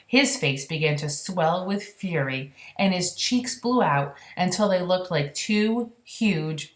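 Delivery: usual speaking voice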